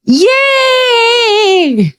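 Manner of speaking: a little victory whoop; joyful cheer. Real, not acted. Preacher celebrating her name change, high joyful cheer. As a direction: shout "Yeay!" high and triumphant.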